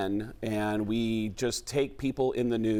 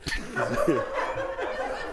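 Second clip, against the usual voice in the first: breathy gasp; 3 semitones higher than usual. Shown as a demonstration. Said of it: "This is the whole Mark Wahlberg impression".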